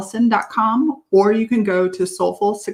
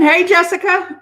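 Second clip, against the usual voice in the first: calling out; 9 semitones higher, 4 dB louder than usual. Real, not acted. Greeting a viewer in chat.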